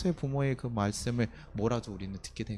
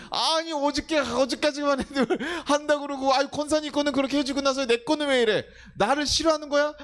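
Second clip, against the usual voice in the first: much higher, louder aggrieved whining; whiny complaint; 6 dB louder than usual. Retold or imitated. A believer grumbles to God about favoritism, high whiny complaint. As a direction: whine like someone shortchanged.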